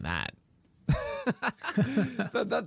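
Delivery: sudden high-pitched laugh. Real, not acted. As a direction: burst out laughing. Laughing at own draft pick.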